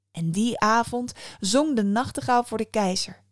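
ordinary delivery, nothing marked

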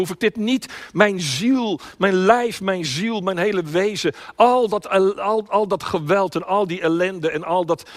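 high, loud, urgent begging; desperate pleading. Acted. Preacher as Jesus begging Papa for another way, desperate.

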